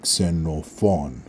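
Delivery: Xenophon slowly broken into three syllables, stress on the last. Shown as a demonstration. Teacher breaking up Xenophon slowly for learners. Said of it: "Now breaking it up".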